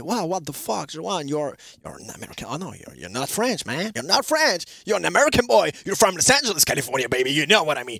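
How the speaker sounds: répliques anglaises lancées comme un Américain enthousiaste; imitation d'Américains. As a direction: imite les fêtards américains qui le complimentent. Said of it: "Ils me disaient". (imitation légère d'Américains enthousiastes, en anglais)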